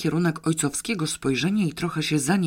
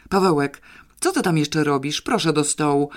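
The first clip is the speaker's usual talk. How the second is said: ordinary delivery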